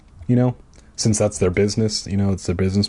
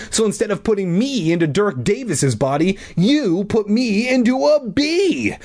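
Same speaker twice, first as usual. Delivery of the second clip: high, indignant acted rant; angry shout; 8 semitones higher than usual. A narrator's reading. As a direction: accuse in a raised pitch, drawing out the end. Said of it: "I shouted angrily".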